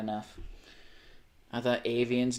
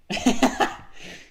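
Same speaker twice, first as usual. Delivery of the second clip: high, loud cackling burst of laughter; amused laugh; 12 semitones higher, 7 dB louder than usual. Real, not acted. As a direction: burst out laughing at a funny picture. Amused by a picture.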